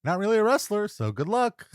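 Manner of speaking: sugary fake concern, a hint of a smirk; lightly voiced fake-nice boss wishing luck; fake nice guy. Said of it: "He's playing, like, this nice guy".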